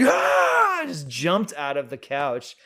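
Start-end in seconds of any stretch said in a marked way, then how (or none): 0.0-0.8 very high, loud scream of fright; terrified teenage shriek; startled scream. Retold or imitated. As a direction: scream like you just got jumped. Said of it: "And I'm just like".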